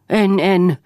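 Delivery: high, loud refusal; shouting. A narrator's reading. Husband refusing his wife's plea to rest, shouting. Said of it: "huusi Eero".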